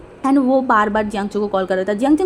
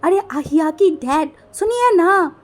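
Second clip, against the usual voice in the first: coaxing sweetly; 6 semitones higher, 4 dB louder, slower than usual. Acted. Wife coaxing her husband sweetly.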